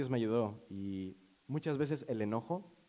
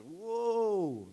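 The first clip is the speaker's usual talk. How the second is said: high drawn-out amazed wow; stunned reaction at a reckless Jeep; 12 semitones higher, 4 dB louder than usual. Retold or imitated. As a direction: gasp it out in disbelief, way up high.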